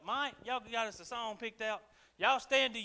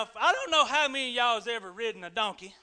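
usual speaking voice

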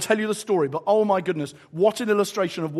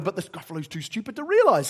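ordinary talk, nothing marked

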